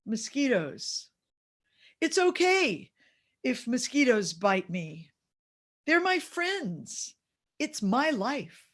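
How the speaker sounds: voicing a grandson's proud little declarations; four-year-old grandson. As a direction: recite it like an earnest small child, drawn out. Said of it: "a couple of quotes from him".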